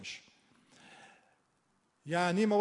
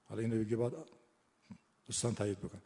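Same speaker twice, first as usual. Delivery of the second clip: ordinary talk, nothing marked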